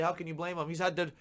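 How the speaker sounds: usual speaking voice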